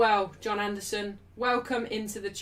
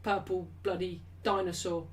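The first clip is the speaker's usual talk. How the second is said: usual speaking voice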